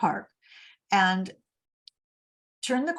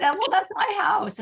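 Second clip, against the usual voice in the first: alarmed; 11 semitones higher, 3 dB louder than usual. Retold or imitated. Alarmed at police at home.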